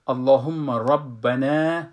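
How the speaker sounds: اللهم ربنا slow with a heavy ra; full ra. Shown as a demonstration. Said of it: "ربنا نخوانین".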